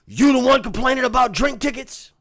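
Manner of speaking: loud, high, in-your-face yell; a manager shouting an accusation; confrontational shout. Retold or imitated. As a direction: get right up in his face and shout it. Manager confronting him in his face.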